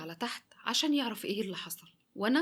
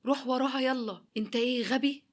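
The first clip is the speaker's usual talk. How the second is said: retold yell; 3 semitones higher, 3 dB louder than usual. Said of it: "وساعتها أخوه زعقله".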